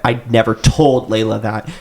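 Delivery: ordinary delivery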